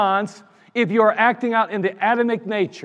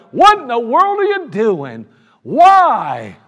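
louder, higher cry of why at God; exasperated outcry; 3 semitones higher, 7 dB louder, slower than usual. Retold or imitated. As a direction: throw the question up in frustration. Crying out to God in frustration.

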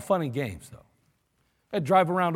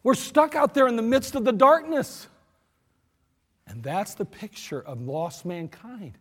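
ordinary talk, nothing marked

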